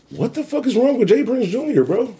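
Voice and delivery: high-pitched disbelieving cry of what the fuck; exasperated outburst. Real, not acted. Vlogger venting to viewers about Jay Prince, high exasperated disbelief.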